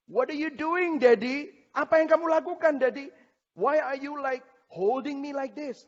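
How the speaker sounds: put-on high, bewildered little-child pleading; scared toddler voice. Toddler asking daddy why he holds him, frightened child. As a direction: voice the two-year-old, confused and alarmed. Toddler questions his father.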